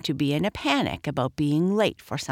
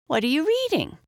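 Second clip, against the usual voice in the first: put-on girl's question; 11 semitones higher, faster than usual. A narrator's reading. Narrator voices a curious girl.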